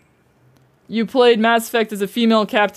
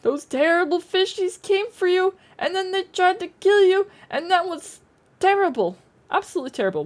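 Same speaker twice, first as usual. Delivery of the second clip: mock baby-talk lament; 9 semitones higher than usual.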